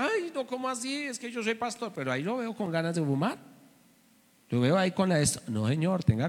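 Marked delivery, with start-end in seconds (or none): 0.0-1.9 high, much softer mock protest; imitating the ex-pastor. Pastor mimics the fallen pastor's excuse, high and soft. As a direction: play him off as a whiny excuse. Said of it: "Me decía".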